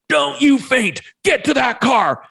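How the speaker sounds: high, loud commanding wife; wife ordering him to the car, mock high commanding voice; wife's warrior mode. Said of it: "what we call her warrior mode".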